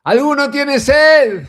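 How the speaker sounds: Jesus calling out at the feast, loud and drawn out; crying out. Retold or imitated. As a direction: lift the voice and call out to the crowd. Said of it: "la voz gritando".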